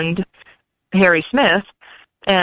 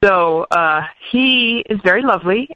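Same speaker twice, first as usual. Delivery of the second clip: normal talking voice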